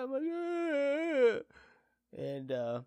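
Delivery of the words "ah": loud high-pitched fake cry; mock bawling. Husband reacting to wife on phone, mock bawling. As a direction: let out a hammed-up sob. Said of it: "I absolutely bawled my eyes out".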